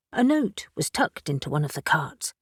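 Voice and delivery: ordinary delivery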